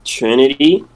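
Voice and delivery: ordinary talk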